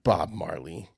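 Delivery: low, flat, soft [name]; deadpan [name]. Retold or imitated. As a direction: drop it low and dry. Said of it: "you were like".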